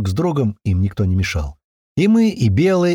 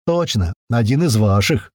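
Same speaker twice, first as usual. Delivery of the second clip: ordinary delivery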